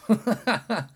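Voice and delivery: sudden high loud laugh breaking out; a loud laugh that bursts out at himself; loud burst of laughter. Real, not acted. Podcaster laughing at his own irritation, loud laugh.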